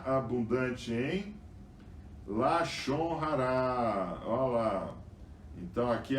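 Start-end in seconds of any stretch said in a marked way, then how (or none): 2.2-4.2 "Lashon Hará" drawn out very slowly, each syllable weighed; emphatic term. Acted. Preacher stresses key Hebrew term. ("Lashon Hará" bem devagar e enfático)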